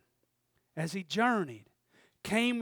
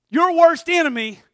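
worst hit high and loud; shouted emphasis; 9 semitones higher, 16 dB louder than usual. Real, not acted. Preacher shouting to congregation, worst punched. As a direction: shout your worst enemy at the crowd.

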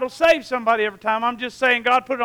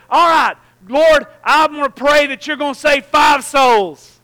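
slow, loud, higher call to the Lord; bold shouted prayer; 3 semitones higher, 5 dB louder, slower than usual. Retold or imitated. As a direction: pray out boldly, drawing the words out.

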